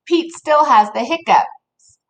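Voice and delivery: ordinary delivery, nothing marked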